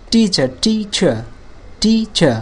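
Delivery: slow two-beat teacher falling onto চার; two separate parts. Shown as a demonstration. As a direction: divide each teacher after টি. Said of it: "দুইটা অংশ".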